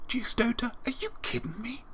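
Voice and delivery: high whispered disbelief; overwhelmed hushed whisper; stunned whisper. Acted. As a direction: breathe it out in mock shock. Stunned reaction after poem.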